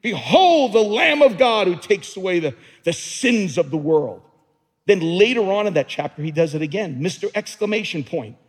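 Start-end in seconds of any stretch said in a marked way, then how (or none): 0.0-4.2 loud proclaiming shout, Behold ringing out; screaming it out. Shown as a demonstration. Preacher shouting John's cry of Behold to the church. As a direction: shout Behold like a prophet crying out. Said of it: "Screaming it".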